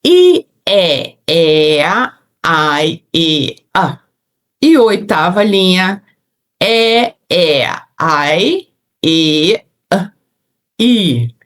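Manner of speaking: isolated vowels "I, E, A", each held and separated; vowel drill. Shown as a demonstration. Teacher playing with vowels for students, vowel drill. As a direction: draw out each vowel cleanly with pauses. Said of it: "a gente brincou com um monte de vogais".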